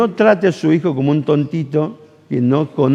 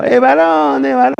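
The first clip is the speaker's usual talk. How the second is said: long ringing holler, pitched way up; shouting the news; 13 semitones higher, 4 dB louder than usual. Retold or imitated. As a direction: cry it out for the whole place to hear.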